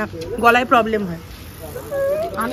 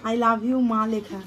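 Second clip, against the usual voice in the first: touched reading; 4 semitones lower, 7 dB quieter, much slower than usual. Real, not acted. Mother moved reading card.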